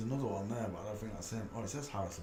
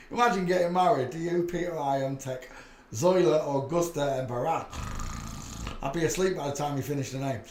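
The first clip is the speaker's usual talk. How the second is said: amused; 4 semitones higher, 8 dB louder than usual. Real, not acted. Mocking a long name.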